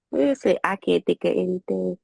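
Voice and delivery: usual speaking voice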